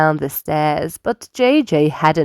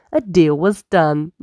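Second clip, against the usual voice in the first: ordinary delivery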